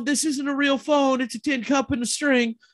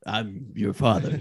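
low, slow fatherly voice; deep dad voice; 14 semitones lower, 4 dB quieter, slower than usual. Pretend.

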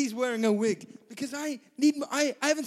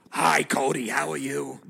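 lightly put-on lower voice as Trish; 8 semitones lower than usual. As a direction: pretend to be Trish on the mic. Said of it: "can I put Trish on the microphone".

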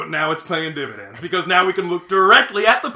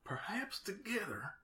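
breathless whispered question; 2 semitones higher, 22 dB quieter, slower than usual. Acted. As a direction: whisper it back, slow and unsure. Repeats partner's line back.

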